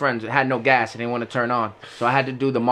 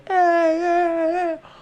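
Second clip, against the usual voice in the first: high mock coworkers calling out; coworkers yelling; much slower than usual. Coworkers shout at donut driver, high mock calls. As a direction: call out like startled coworkers. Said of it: "All my coworkers are".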